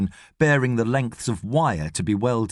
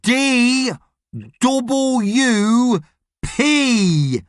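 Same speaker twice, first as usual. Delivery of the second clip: each letter held, high and separate; enunciating; 10 semitones higher, 4 dB louder, much slower than usual. A narrator's reading. Boss spelling out initials to foreign workers, enunciating. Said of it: "he enunciates".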